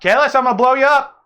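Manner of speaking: high, loud warning yell; loud, high in-character threat; shouted threat. Acted. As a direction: yell it like you will really do it.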